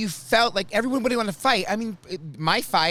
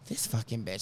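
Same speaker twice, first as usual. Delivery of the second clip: muttered thought; 8 semitones lower, 10 dB quieter than usual. Retold or imitated. Inner thought about Karen.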